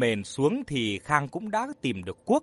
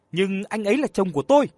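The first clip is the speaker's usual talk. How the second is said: louder, higher, fast shout; 5 semitones higher, 5 dB louder, faster than usual. Acted. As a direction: yell it at her rival. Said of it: "Nguyệt hét lên". (hét lên giận dữ)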